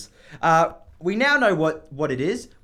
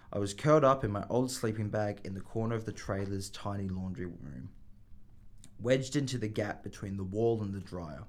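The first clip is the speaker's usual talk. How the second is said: low, steady audiobook reading; story narration; 7 semitones lower, 7 dB quieter than usual. Acted. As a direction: read it like a calm audiobook. Reading the novel aloud.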